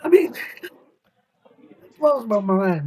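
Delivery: breaking into a teary laugh mid-phrase; laughing through tears. Real, not acted. Friend's plight overwhelms him, teary laugh. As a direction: let the laugh crack with emotion. Moved talking about friend's illness.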